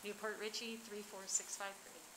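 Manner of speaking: ordinary delivery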